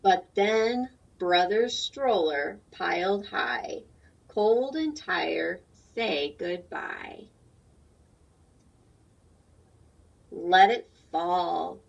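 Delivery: slow, loud storybook reading; reading to children. A narrator's reading. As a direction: read it slowly and fully to the class. Reads picture book aloud.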